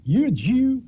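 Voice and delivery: a bigot taunting the soldier; menacing bully. Retold or imitated. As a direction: call it out like a barracks bully. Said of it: "he says".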